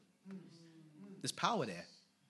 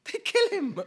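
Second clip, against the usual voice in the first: much higher, loud outraged cry; 12 dB louder than usual. Real, not acted. Climax of the sermon.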